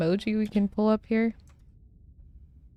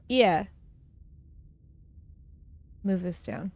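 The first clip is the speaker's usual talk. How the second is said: ordinary talk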